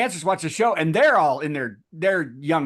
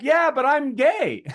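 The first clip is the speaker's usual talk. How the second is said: mock magician excuse; 10 semitones higher, slower than usual. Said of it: "he was like".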